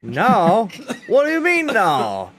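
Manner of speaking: high, loud protest; indignant outburst. Real, not acted. Contradicted by a friend over a name, loud indignant protest. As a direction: pitch shoots up in disbelief, then falls. Mock outrage at being contradicted.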